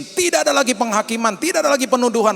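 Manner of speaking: loud, high, fervent preaching; preaching at full force. Acted. Pastor proclaiming Paul's teaching to the church, fervent. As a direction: drive the point home to the congregation.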